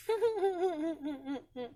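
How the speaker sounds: short high laugh. Real, not acted. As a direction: laugh at your own scheme. Laughs after whisper.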